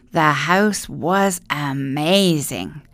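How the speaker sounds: ordinary delivery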